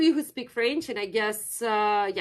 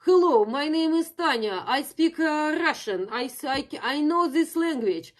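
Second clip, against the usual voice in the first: exaggerated Russian accent, pitched higher; higher, halting Russian-accented English; 6 semitones higher, 3 dB louder than usual. Shown as a demonstration. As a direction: demonstrate how Russians speak English. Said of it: "like, with the Russian accent".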